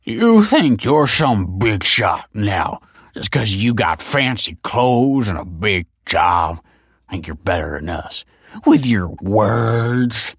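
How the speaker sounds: put-on very slow, loud drunken jeer; slurring drunk. A narrator's reading. Drunk Tony sneers at Kelsey's success, slow and slurred. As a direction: drag the words out, slurred and scornful. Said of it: "slurred Tony".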